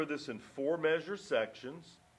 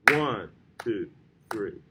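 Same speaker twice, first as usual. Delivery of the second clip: "One" punched louder, then two, three lighter; accent on one; 6 semitones lower, 4 dB louder, slower than usual. Shown as a demonstration. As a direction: hit the beat on "One". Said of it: "more emphasis on the accented notes".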